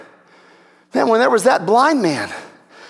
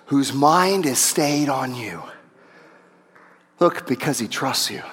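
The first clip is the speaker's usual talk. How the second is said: much lower and softer preaching; hushed sermon; 6 semitones lower, 4 dB quieter than usual. Acted. Drops into a hushed teaching voice.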